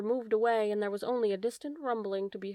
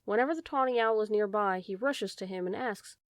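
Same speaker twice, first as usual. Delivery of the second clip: ordinary delivery, nothing marked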